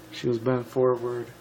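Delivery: ordinary delivery, nothing marked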